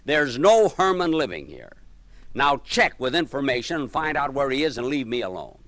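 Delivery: loud, fed-up tirade at the repeat caller; angry lady. Retold or imitated. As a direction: tell him off loudly, she has had enough. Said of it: "Now, this time she's angry".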